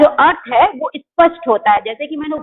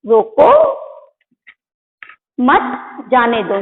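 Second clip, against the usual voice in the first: रोको held up, long stop, then lower मत, जाने दो; pause after रोको; 3 semitones lower, slower than usual. Shown as a demonstration. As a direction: break firmly after रोको, keep मत with जाने दो. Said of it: "अल्प विराम लगा है".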